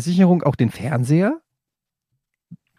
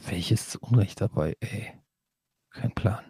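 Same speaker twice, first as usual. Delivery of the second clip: quiet mumble; 6 semitones lower, 9 dB quieter than usual. Real, not acted. Muttering the question back.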